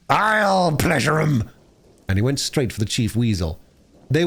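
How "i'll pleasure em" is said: slow, high, savage yell of the song; furious yell. Acted. Toad charging the Chief Weasel, yelling his song back. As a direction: throw their song back at them. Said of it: "he yelled".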